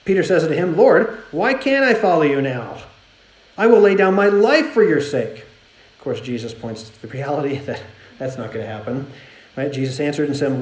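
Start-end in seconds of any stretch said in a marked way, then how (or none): 0.8-5.4 louder, higher pleading protest; impassioned plea. Acted. Peter protesting to Jesus that he will follow him, impassioned plea. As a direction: plead with raised voice, mildly acted.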